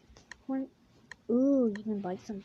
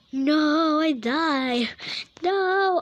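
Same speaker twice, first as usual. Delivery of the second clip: much louder and higher no; frustrated cry; 6 semitones higher, 9 dB louder, much slower than usual. Real, not acted. Gamer dying in the level, crying out in dismay. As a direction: wail it as you lose. Character dies in game.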